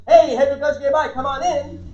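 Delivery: loud, high, gregarious welcome; booming greeting. Acted. Gregarious client welcoming someone in, booming. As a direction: boom out a hearty hello. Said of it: "more boom in their voice".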